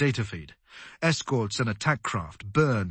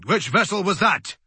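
raised, high-pitched demand; 6 semitones higher, 4 dB louder than usual. A narrator's reading. Commander questioning his crew as a ship explodes, demanding. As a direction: demand an answer at once. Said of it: "he demanded".

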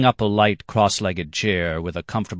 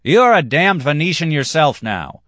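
much higher, louder accusation; angry jab; 10 semitones higher, 7 dB louder than usual. Acted. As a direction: hurl it across the table. Quarrel between diners.

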